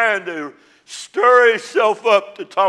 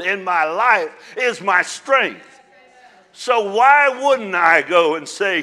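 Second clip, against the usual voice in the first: louder, rising, fervent preaching; full-force preaching; 3 semitones higher, 5 dB louder than usual. Acted. Pastor rousing the congregation about joy in hard days, fervent preaching.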